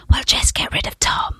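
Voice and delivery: conspiratorial whisper; hushed, secret plotting voice. Retold or imitated. Retold bosses' decision, whispered.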